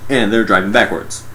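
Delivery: ordinary talk, nothing marked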